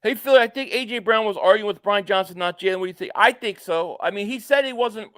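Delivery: usual speaking voice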